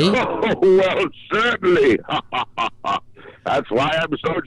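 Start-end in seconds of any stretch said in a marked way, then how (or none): 0.0-2.1 laughing, high and drawn-out; jolly, stretched-out answer between laughs; laughing reply. Real, not acted. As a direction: say it slowly through a high laugh. Jokey reply to early smoking question.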